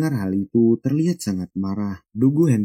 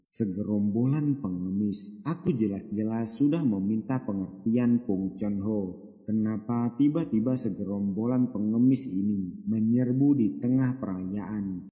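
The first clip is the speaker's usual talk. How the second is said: teeth-gritted mutter; 9 dB quieter than usual. A narrator's reading. Host seething to himself as beggars crash his feast, muttered softly. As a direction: grumble it under your breath, barely audible. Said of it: "mengeratkan giginya bergumam".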